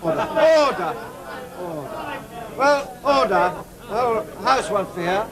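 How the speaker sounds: firm call to order over noise. Real, not acted. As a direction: call the rowdy House to order. Speaker calling House to order.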